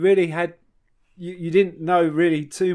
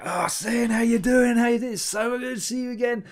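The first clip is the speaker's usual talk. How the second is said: imitating friend; 9 semitones higher than usual. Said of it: "Reform the Mind was like".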